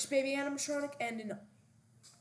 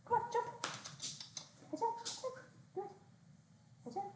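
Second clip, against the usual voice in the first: high coaxing pet voice; calling a dog; 10 semitones higher, 8 dB quieter, faster than usual. Real, not acted. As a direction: urge the puppy up.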